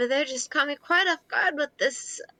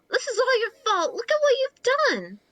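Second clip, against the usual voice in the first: high-pitched blame; 13 semitones higher, faster than usual. Acted. Furious blame at the clown.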